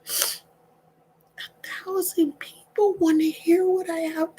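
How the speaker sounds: overwhelmed, slow near-whisper; hushed disbelief. Real, not acted. Speaker stunned that a thousand people want to listen, hushed disbelief. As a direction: barely get it out, soft and halting.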